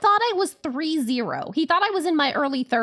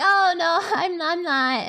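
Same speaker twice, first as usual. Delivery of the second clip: laughing; 6 semitones higher, slower than usual. Retold or imitated. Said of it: "To which I laughed".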